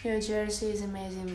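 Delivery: normal talking voice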